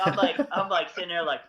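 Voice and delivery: ordinary talk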